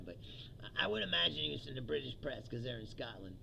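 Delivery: usual speaking voice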